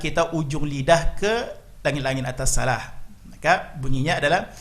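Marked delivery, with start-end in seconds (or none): none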